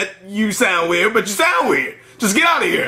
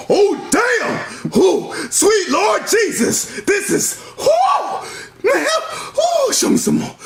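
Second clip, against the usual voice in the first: excited shout; 12 semitones higher, much slower than usual. Acted. Excitedly watching the chicks.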